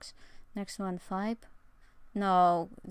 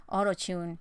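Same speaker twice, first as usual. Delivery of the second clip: ordinary talk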